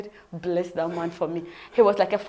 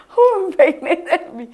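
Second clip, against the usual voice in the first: shocked disbelief; 8 semitones higher, 11 dB louder, slower than usual. Retold or imitated. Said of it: "I was like".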